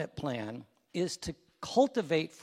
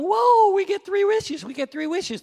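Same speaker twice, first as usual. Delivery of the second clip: high, loud whoop of amazement; excited castaways; 14 semitones higher, 9 dB louder than usual. Acted. Castaways cheering at the genie's three wishes, excited. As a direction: cry out together, thrilled and high-pitched.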